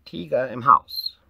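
loud, high, drawn-out fox echo. Acted. Fox echoing bear's request, high loud drawn-out voice. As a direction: repeat it loudly and slowly as a fox.

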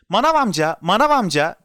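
put-on high, loud child's call; calling out loudly. A narrator's reading. Boy hailing the greengrocer, shouting. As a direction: shout for the grocer's attention. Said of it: "diye haykırdı".

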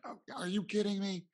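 imitating his own panicked outburst at his son; retold freak-out. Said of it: "I freaked out with him".

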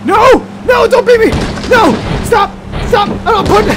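loud, high cries of no and stop; frantic pleading yell. Real, not acted. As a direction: beg them to stop at full volume. Being rammed, pleading.

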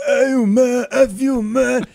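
high, loud mock cursing heckler; imitating heckler cursing. Comedian retelling a heckler cursing him, high loud mock yell. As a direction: throw the curse like the angry heckler. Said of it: "he started cursing".

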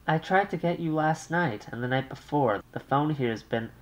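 light high polite thank-you; brisk thanks to the operator; Muriel's polite thanks. Acted. Young wife thanks the operator, lightly polite.

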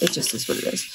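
softly whispered shrug; resigned whisper. Real, not acted. Folding laundry, muttering resignation under her breath. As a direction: let it go under your breath.

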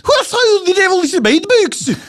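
very high Welsh-Scottish mock accent. Topping his partner's bit, mock Welsh-Scottish accent. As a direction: squeal it like a Welsh-Scottish gent. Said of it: "mixture between Welsh and Scottish".

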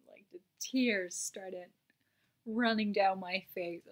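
normal talking voice

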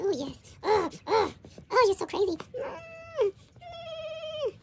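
high, sing-song voice for the kitten Karen; voicing the kitten.

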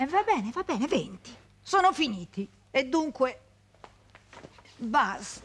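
loud, high-pitched exasperation; impatient dismissal. Acted. Woman tells former lover the twenty days are over, impatient dismissal. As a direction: cut the pleading short with finality.

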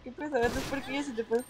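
ordinary talk, nothing marked

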